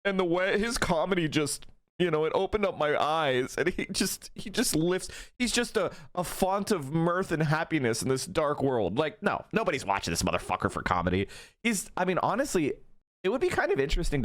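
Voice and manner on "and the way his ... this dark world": mock fan gushing. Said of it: "It's not like people going like".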